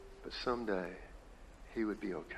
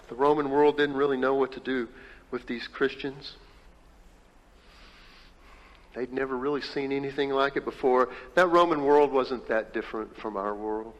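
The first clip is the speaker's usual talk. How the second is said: full preaching; 6 dB louder than usual. Acted. Pastor preaching to the congregation, loud and emphatic. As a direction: hammer each word of the list.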